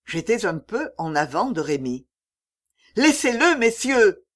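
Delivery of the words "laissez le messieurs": high, loud plea to stop them; cry out. A narrator's reading. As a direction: shout out to protect the boy. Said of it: "m'écriai-je". (cri aigu et fort pour défendre Rémi)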